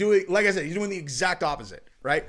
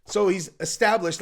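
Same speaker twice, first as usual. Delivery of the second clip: usual speaking voice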